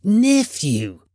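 high, cutting reprimand; stern old uncle's rebuke. A narrator's reading. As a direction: cut him off sternly. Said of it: "returned the uncle sternly".

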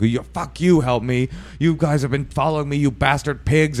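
high, agitated mock rant; crazy caller. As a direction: imitate an irate caller hurling accusations. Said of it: "a crazy person".

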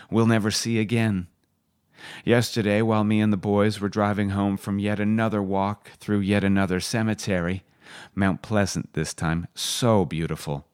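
ordinary talk

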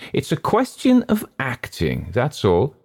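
high, sharp self-justification. A narrator's reading. Hurst insisting it is only acting, sharp. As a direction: defend yourself with some edge. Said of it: "said Hurst sharply".

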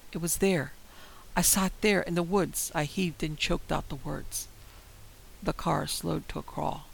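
usual speaking voice

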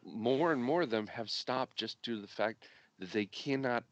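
ordinary talk, nothing marked

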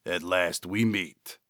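soft, throaty jackal figure; a quiet throaty voice out of shadow. A narrator's reading. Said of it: "came a throaty voice".

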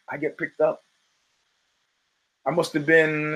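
usual speaking voice

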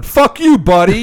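high, loud mock yell; mock shout. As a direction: bark it at the next car. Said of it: "The guy beside you at the light is going".

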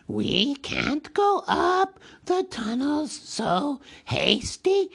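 high, slow, slithering creature voice, swooping wildly in pitch; a sly, wheedling cave creature stalling over his promise; Gollum voice. A narrator's reading. Gollum dodging Bilbo's demand, high and slithering.

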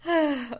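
high, falling sigh after laughing; loud sigh. Real, not acted. Reader recovering from a laughing fit, loud sigh. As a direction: let out a big recovering sigh.

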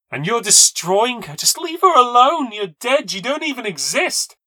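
high-pitched, loud angry attack; furious shouting. Acted. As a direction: lash out at the rival, shouting. Furious quarrel between narrator and girl.

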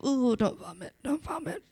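hushed, strained mock gagging; quiet panicked self-talk; holding back nausea; queasy. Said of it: "the giver ... be like".

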